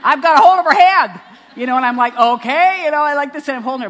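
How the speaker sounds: loud, high mock shout, easing slightly by the end; exclaiming. Mother still waiting at the altar with the bride, shouting. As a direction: holler it like someone left stranded up front.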